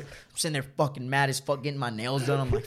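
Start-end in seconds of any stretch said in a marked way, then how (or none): none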